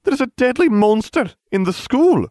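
strict teacher snapping at a pupil; snapped. A narrator's reading. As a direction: cut him off with a stern warning. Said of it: "she snapped".

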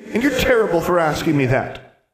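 put-on a wounded child lashing back; indignant ghost girl. Ghost girl scolding Alexandra, high indignant child voice.

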